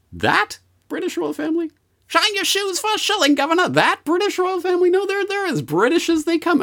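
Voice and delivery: put-on high squeaky Cockney shoeshine boy; mock Cockney urchin. Shoeshine boy touting the royals in Cockney.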